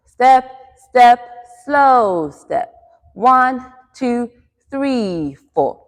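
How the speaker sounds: punchy loud steps, then a stretched slow; quick-quick-slow count. Shown as a demonstration. Teacher drills hips from in to out, calling the rhythm. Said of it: "use the quick, quick, slow rhythm".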